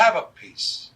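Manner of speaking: high, loud, pushy offer; loud urging. Retold or imitated. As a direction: press food on a reluctant friend. Urging his companion to try chicken.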